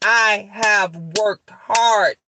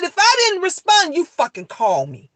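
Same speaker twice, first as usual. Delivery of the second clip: stern scolding; 6 semitones higher than usual. Retold or imitated. Mother scolding her son in front of the police, stern. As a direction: lay down the law to her son. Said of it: "I said it just like that to the officer".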